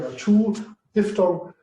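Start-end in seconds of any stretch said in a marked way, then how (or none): 0.2-0.6 "two" with a long, held vowel. Shown as a demonstration. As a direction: stretch the oo of "two", higher pitched. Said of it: "it's long, both is long".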